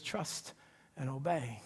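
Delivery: low, soft and hushed; God's soft spoken reply to the weary believer. Acted. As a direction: answer gently from a distance, almost breathed. God answering in prayer.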